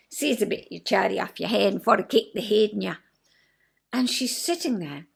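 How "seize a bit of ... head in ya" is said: amateur broad Scots phrase; put-on Scots, practised line by heart; rehearsed Scottish accent. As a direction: recite it in learned Scottish dialect. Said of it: "that's what I said".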